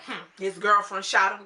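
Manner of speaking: loud mock-mad retort; mad, assured. Mom answering how he died, imitated mad and assured. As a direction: imitate her, mad and sure of herself. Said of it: "she said that so mad and so".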